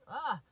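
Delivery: overwhelmed gasp breaking through the talk; emotional gasp. Real, not acted. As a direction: let out a choked cry as the tears come. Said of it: "I'm a little emotional".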